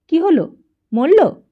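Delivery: high, loud, blunt "is he dead?"; eager callous outburst; brash eager question. A narrator's reading. Rowdy neighbour barging into the sickroom, loud callous question. Rowdy man bursts in asking.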